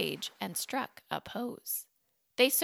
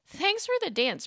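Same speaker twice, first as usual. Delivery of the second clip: bright thanks; 10 semitones higher than usual. Acted. Boney politely exits.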